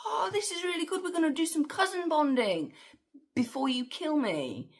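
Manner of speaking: very high, gleeful villain voice; gleeful Feyd. Retold or imitated. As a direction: toy with your cousin, delighted to meet him before the kill. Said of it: "Fade acknowledges".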